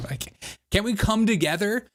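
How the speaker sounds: usual speaking voice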